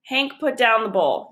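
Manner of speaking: ordinary delivery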